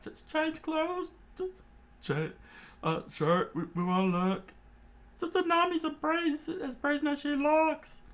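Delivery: stammer, caught off guard; flustered high voices. Acted. Johnny and Yosaku flustered by Nami, high.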